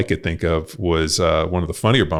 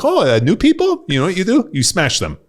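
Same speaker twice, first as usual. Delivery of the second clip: much higher, louder mock eagerness; hammed-up excited coach; 12 semitones higher, 4 dB louder than usual. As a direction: gleefully egg on the new guy. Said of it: "I'm like".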